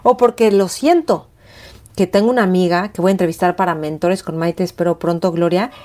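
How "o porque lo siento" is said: high, fast burst of self-justification; breathless insistent plea; urgent protest. Retold or imitated. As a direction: rush out the need to be angry. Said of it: "era como".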